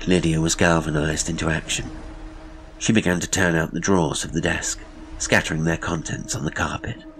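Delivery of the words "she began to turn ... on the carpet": ordinary delivery, nothing marked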